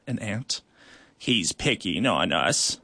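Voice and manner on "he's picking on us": a low, drawn-out complaint; low, slow ant voice. Acted. Ant sister complaining to the queen, low and slow. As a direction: sulk it out slowly to the queen.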